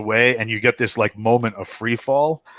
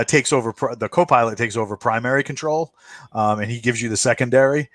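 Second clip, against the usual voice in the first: ordinary delivery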